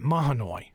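"Mahanoy" in three syllables, H sounded; outsider pronunciation. Shown as a demonstration. Host showing how he always pronounced it, "Mahanoy" with the H sounded. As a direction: say "Mahanoy" as MAH-huh-noy. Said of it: "I've always pronounced it".

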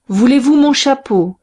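a policeman mocking the thieves from the door; call from the doorway. A narrator's reading. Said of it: "cria une voix du seuil".

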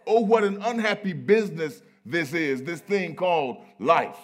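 loud, high preacher's lament; big oratorical wail of complaint; full-force preaching. Acted. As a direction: cry it out from the pulpit. Preaching as the weary Solomon.

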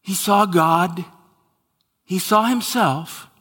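rising, emphatic preacher cadence; preaching. Acted. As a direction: build each line on the word saw. Preacher building his point.

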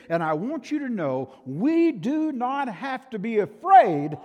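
rising to a loud, high peak on Lord; fiery preaching. Acted. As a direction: preach it out, slowing hard on the Lord. Preaching at full force.

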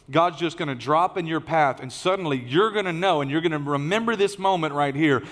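ordinary talk, nothing marked

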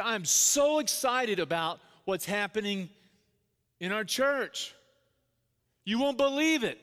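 imitating a thrilled chairman, high and loud; fired up. Board chairman teasing big church news to dad, fired up. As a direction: build it like huge news is coming. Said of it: "He's fired up".

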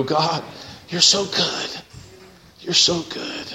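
hushed, awed praise to God; softly voiced awe; hushed worship. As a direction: murmur it like a grateful worshipper.

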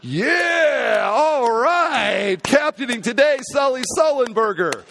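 high, loud crowd cheer; excited cheering. Retold or imitated. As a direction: cheer his name like a relieved crowd. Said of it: "everyone's like".

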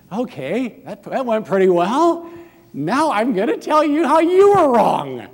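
high, loud, hammed-up gloating; mock gloating turn. Apologizer gloating at the other person, turning on them loudly and high. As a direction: gleefully flip to blaming them. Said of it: "No, that's not the way to go".

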